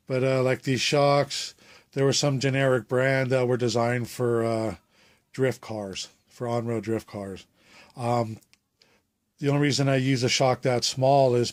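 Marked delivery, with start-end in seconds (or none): none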